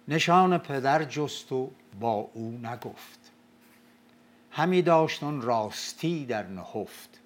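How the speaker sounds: slow, loud Shahnameh chant; epic verse recital. Acted. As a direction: declaim it like an old epic.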